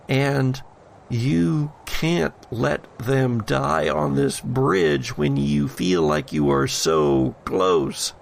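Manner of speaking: ordinary talk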